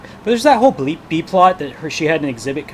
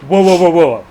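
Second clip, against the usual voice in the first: higher, louder whoa to halt the fight; a dad cutting the quarrel off, raised voice; mock calming shout; 5 semitones higher, 11 dB louder than usual. Father stops family argument.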